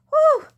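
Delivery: big, high, loud exhale of relief; loud winded whew. Real, not acted. Worn-out woman blowing out a breath after heavy lifting. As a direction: blow the air out hard, spent. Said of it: "Can you hear me breathing?".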